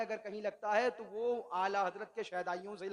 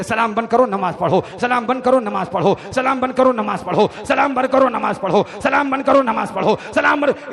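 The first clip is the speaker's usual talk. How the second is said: loud, relentless mimicked drilling; brainwash करती रट; 4 semitones higher, 22 dB louder than usual. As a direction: chant the orders in an unbroken see-saw loop. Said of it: "इतनी बार कहा कि brain wash कर दिया". (ज़ोर से लगातार रटाने की नकल)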